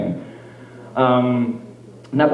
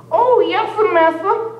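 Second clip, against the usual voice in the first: much higher, meek put-on voice; mock servile slave; slower than usual. Roleplaying couple's reply to the master, high and meek. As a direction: play the eager servant, pitched up.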